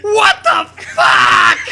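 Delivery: high, loud, slow hammed-up outrage; slow, shouted mock disbelief. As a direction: drag it out in disbelief. Shocked reaction in reading.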